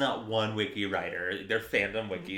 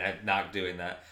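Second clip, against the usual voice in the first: normal talking voice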